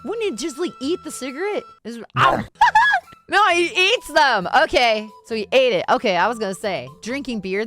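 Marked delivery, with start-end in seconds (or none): 2.5-3.2 short shrieking laugh; high laugh. Real, not acted. Laughs at cigarette.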